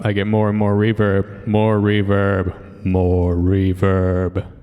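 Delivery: words stretched out slowly into growing echo; building reverb. Shown as a demonstration. Showing the reverb knob going up, voice slow and echoing. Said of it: "as I turn this knob up".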